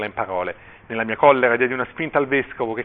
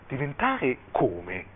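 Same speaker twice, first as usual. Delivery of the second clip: dazed question; 7 dB quieter, much slower than usual. A narrator's reading. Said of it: "domandò".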